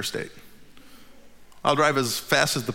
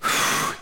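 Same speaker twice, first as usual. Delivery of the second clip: weary sigh. Real, not acted. Tired man resigned to borrowing a van, sigh. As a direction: let the tiredness out in one breath. Wife demands he come.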